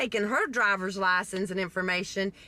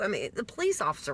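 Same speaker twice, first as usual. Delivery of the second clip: usual speaking voice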